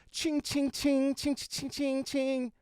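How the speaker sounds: high sung cash-register ching; playful high-pitched money jingle; cha-ching cash sound. Pretend. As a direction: ring up the money like a till. Cash register noise after album sales.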